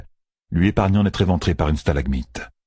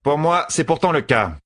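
higher-pitched, mildly grumbling sister; 10 semitones higher than usual. A narrator's reading. Sister complaining to Fail about being kept back, grumbling. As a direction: grumble in a raised character voice. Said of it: "ronchonna la sœur".

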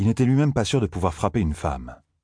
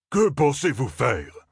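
higher, slow indignant outburst; indignant cry; 7 semitones higher, slower than usual. A narrator's reading. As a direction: scold the armed groups in outrage. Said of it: "s'écria-t-il". (cri indigné, lent et plus aigu)

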